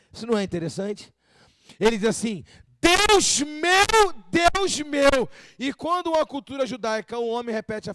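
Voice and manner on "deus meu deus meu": loud, high exclamation, drawn out; anguished shout to God, stretched on each word; emphatic cry to God. Acted. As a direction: cry it out like a doubled exclamation mark. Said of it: "você tá querendo, tá gritando".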